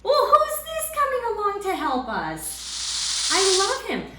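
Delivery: high, bright-eyed hello to a new friend; gushing over a beloved pet; excited greeting. Real, not acted. Teacher greets the snake puppet.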